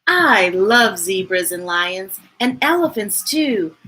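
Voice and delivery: lively sing-song picture-book reading; reading to a child. A narrator's reading. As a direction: read it bright and bouncy for a little one. Reading a rhyming picture book aloud.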